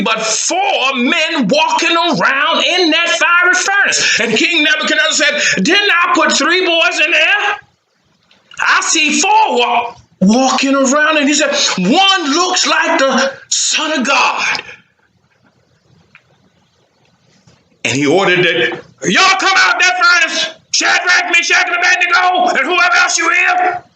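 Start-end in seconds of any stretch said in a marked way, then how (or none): 0.0-4.2 slow, much higher climactic call; excited narration. A narrator's reading. The preacher reveals the fourth man, slow and high. 5.6-23.8 much higher, calling out in amazement; the king can't believe his eyes; astonished king. Acted. The king calling the boys from the furnace, high and amazed.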